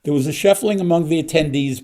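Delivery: usual speaking voice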